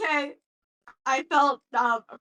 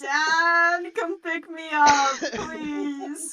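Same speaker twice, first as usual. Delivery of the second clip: mock crying child; 3 semitones higher, 7 dB louder, much slower than usual. Mock pleading to be rescued.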